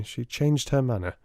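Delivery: usual speaking voice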